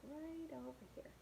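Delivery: ordinary delivery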